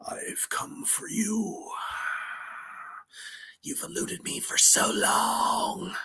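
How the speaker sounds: breathy menacing devil whisper, slow and hushed; cliché devil whisper. Retold or imitated. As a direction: hiss it like a stock movie devil. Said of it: "how many times we see the devil and it's always".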